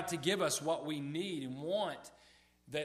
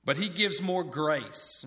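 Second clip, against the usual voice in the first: scripture reading; 2 semitones higher, 10 dB louder, slower than usual. Acted. Reading the verse aloud.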